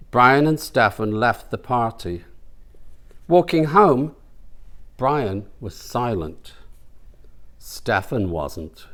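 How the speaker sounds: plain reading voice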